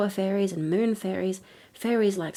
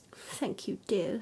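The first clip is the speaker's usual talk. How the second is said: smiling through tears; 8 dB quieter, slower than usual. A narrator's reading. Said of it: "smiling through her tears".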